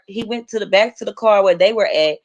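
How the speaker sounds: usual speaking voice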